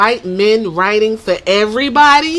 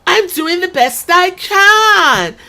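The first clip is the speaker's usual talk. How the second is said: put-on valley girl voice; 7 semitones higher, 3 dB louder than usual. Shown as a demonstration. Mocking a Paris Hilton-like wrestler, high and whiny. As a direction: whine it like a spoiled socialite. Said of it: "talk like this".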